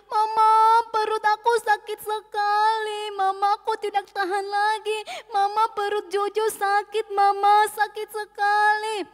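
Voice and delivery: acted wailing child begging for mother; child in pain. A narrator's reading. Jojo curled up tells mama it hurts, pained crying. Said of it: "sedang meringkuk menahan sakit perutnya".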